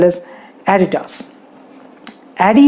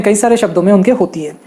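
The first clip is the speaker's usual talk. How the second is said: ordinary delivery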